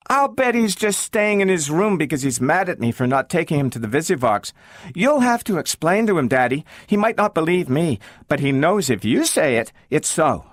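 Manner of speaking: put-on high child voice, pleading; girl wanting Robbie to see the dog; eager little girl. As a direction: chatter insistently.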